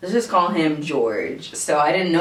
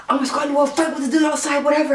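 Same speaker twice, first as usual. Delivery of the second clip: tough guy voice; 9 semitones higher, faster than usual. Retold or imitated.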